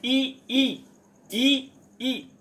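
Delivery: ई short, ई stretched, high and loud; short and long vowel demo. Shown as a demonstration. Teacher contrasting the two ई matras. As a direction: sing out short ई then long ई. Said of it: "मैंने आगे पीछे करके दोनों ई ई को बोला है".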